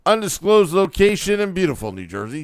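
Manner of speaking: high, punchy show-opening announcement; show opener. Real, not acted. Host opening a late-night Devils broadcast, punchy announcer. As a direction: announce the live show with gusto.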